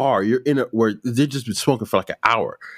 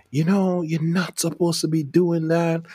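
raised scolding aunt voice; 6 semitones higher than usual. Retold or imitated.

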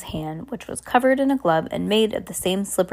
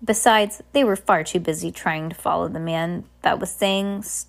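ordinary delivery, nothing marked

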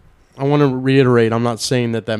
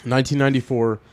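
out of breath; 6 dB quieter than usual. Real, not acted. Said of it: "I'm fat and out of breath".

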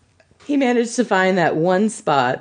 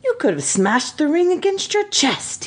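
high, drawn-out wonder; amazed admiration with swooping pitch; in awe; 6 semitones higher, slower than usual. Acted. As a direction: stretch the words out, awestruck. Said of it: "he said in awe".

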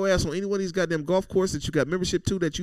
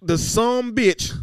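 Southern accent; 5 semitones higher, 7 dB louder than usual. Shown as a demonstration. Host mocking O J on the podcast, Southern accent. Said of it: "I'm gonna say it like I'm Southern".

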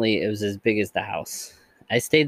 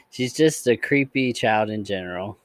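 ordinary delivery, nothing marked

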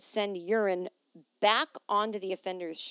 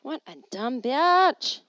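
self-mocking; 4 semitones higher, 8 dB louder, slower than usual. Real, not acted. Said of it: "I wrote that note to myself".

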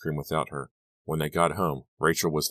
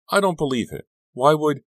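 much higher, louder, cut off; shocked disbelief; 11 semitones higher, 7 dB louder than usual. A narrator's reading. Lizzie to Dawn hearing the killer theory, shocked. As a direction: blurt it out in shock.

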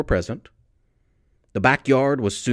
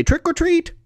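put-on kids' trick or treat; 3 dB louder than usual. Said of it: "The kids say".

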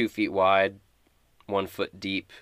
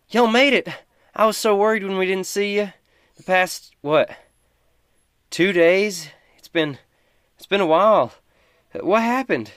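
calls out high and loud, relieved to see them; excited calling out; 11 semitones higher, 8 dB louder than usual. Acted. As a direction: shout a happy welcome across the way, much higher and louder. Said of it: "she kinda calls out".

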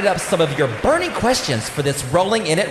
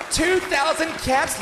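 high, slow, shouted bid; auctioneer shout; 9 semitones higher, 4 dB quieter than usual. Acted. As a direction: belt out the winning bid. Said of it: "auctioneer raises bid".

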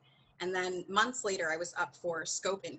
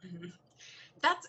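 ordinary talk

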